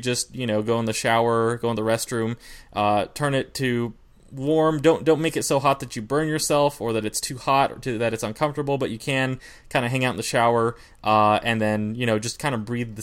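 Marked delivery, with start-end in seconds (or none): none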